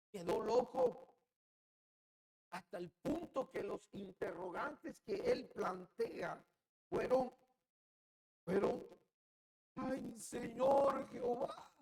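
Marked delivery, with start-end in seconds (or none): 0.1-1.1 cried out high and loud. Shown as a demonstration. Preacher stresses that Ezekiel went mad, high loud cry. Preacher repeats the phrase, louder. 9.7-11.7 anguished lament. Acted. Ezekiel's lament voiced.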